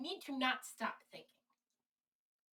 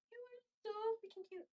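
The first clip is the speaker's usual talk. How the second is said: high pet-talk cooing; 10 semitones higher, 6 dB quieter than usual. Real, not acted. Owner fussing over the cat, high-pitched cooing. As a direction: coo in a little high voice to a pet.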